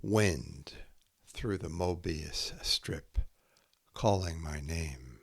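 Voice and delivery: slow, measured haiku reading with long pauses between poems; poetry reading. Acted. Poet reading her own haiku to listeners, slow and measured. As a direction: let each image land before the next.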